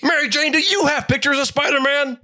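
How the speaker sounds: very high, strained ranting boss; hammed-up newspaper boss tirade; J. Jonah Jameson. Editor demanding Spider-Man pictures from visitors, ranting.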